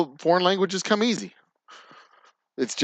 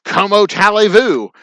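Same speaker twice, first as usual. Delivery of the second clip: put-on "Comment allez-vous" said louder and higher in a thick Texan accent; Texan French; 6 semitones higher, 6 dB louder than usual. Texan greeting the Frenchman, loud Texas-accented French. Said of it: "But then you're like".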